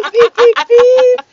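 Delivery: loud high beep beep imitation; horn beeping. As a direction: blare it like a car horn. Imitates car horn blasting.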